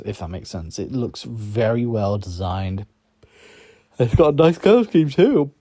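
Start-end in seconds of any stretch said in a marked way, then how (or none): 4.0-5.5 talking through a yawn. Real, not acted. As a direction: yawn mid-sentence while speaking. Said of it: "Oh dear, I'm yawning a lot".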